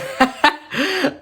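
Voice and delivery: a burst of high laughter; amused high laugh. Real, not acted. Chuckling at his own line to viewers, amused laugh. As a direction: laugh off the swear.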